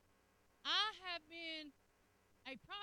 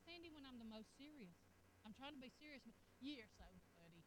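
quieter, fond teasing; soft affectionate husband; 4 semitones lower, 12 dB quieter than usual. Retold or imitated. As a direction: say it low and fond. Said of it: "he'll tell me that".

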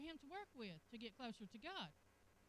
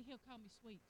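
normal talking voice

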